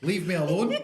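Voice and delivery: high, loud grumpy cat. Retold or imitated. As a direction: voice the cat telling you off.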